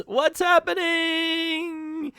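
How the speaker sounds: high, loud, long-stretched cry; mock alarm. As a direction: wail it like being sucked away, hammed-up. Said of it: "he says as he's sucked into".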